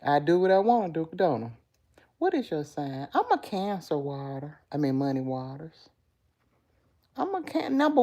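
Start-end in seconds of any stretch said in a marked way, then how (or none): none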